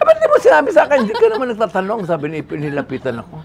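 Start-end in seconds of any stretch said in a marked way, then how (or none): 0.0-2.0 indignant protest. Retold or imitated. Ipe protesting to the director.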